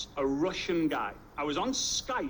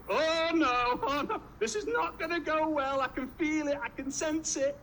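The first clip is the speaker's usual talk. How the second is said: high-pitched comic dread; played-up squeal of alarm; mock panic; 10 semitones higher than usual. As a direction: wail it in a much higher voice, hammed up. Acts his panic at the visitor.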